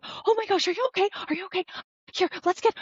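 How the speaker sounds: high-pitched panicky mom imitation; mock freak-out. Mom over toddler's skinned knee, mock panicked fussing. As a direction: fuss frantically, hammed-up. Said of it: "A mom could kinda freak out".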